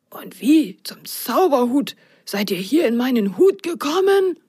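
whispered, rising into a high question; puzzled magician. Acted. Magician wondering how the socks got in his hat. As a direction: ask in hushed wonder. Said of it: "fragte Zaki Zaki verwundert".

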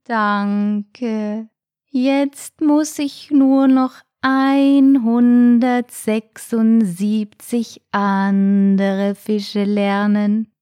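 very slow, drawn-out snail voice; slow, weary snail. A narrator's reading. Snail faces all the fish still to learn, plodding. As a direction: drag out every syllable.

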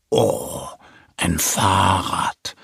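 low, slow wonder; amazed little bear. A narrator's reading. Little bear discovers a bicycle, amazed. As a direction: marvel slowly in a low bear voice. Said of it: "Mo staunt".

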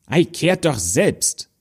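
high, slow and haughty; a spoiled child sneering at the dwarves; rude refusal. Acted. Rude girl refuses to sweep.

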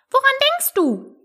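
high-pitched scolding mouse; stern mouse. A narrator's reading. Mouse scolding inattentive Alice, sternly. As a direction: reprimand sharply in a tiny high voice. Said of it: "sagte die Maus strenge".

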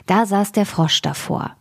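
ordinary delivery

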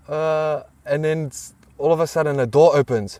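ordinary delivery

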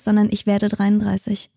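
ordinary talk, nothing marked

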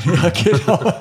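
laughing agreement. Real, not acted. Laughing at self-promotion joke.

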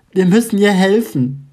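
panicked urgent plea; desperate cry for help. A narrator's reading. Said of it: "starrt Lini verzweifelt".

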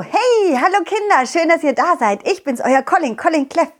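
high, bright puppy greeting; excited cartoon dog; cheerful dog voice. Dog character greets children.

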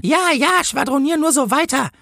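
high-pitched, mocking policewoman; dismissive policewoman. A narrator's reading. Policewoman waves away the angel's talk, high and dismissive. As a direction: brush it off in a high voice. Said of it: "sagte die Politesse".

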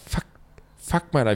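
ordinary talk

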